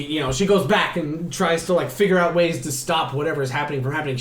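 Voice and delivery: ordinary talk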